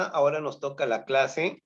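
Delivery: ordinary talk, nothing marked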